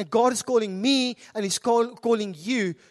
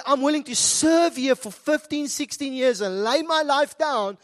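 impassioned preaching, driving and raised; 4 semitones higher, 4 dB louder than usual. Real, not acted. Preacher exhorting congregation to serve with joy, full-force preaching. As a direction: press each phrase home to the congregation with rising fervour.

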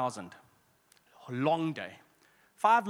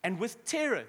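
ordinary talk, nothing marked

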